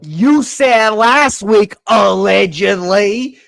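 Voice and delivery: a mock Southern preacher rallying his partner; Southern accent. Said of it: "accent again".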